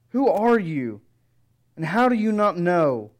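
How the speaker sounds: a touch of exasperated disbelief, pressing the question; lightly voiced frustrated disciple; mild rebuke. Cleopas lightly rebuking the stranger, mildly exasperated. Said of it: "He puts the answer squarely to Jesus".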